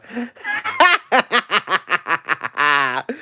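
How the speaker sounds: long run of staccato laughter; long cackle. Real, not acted. Host laughing at an imagined favourite author, long cackle. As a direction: let a big cackle run on and on. Laughs at his own joke.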